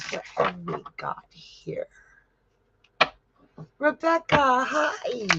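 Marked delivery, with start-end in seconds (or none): 0.1-1.9 whisper. Real, not acted. Peeking at the next question.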